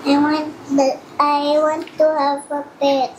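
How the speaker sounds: usual speaking voice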